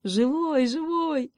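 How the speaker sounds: high, happy exclamation; delighted, high-pitched relief; joyful cry. A narrator's reading. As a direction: cry it out overjoyed, each живой falling from a high start. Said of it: "радостно крикнул заяц".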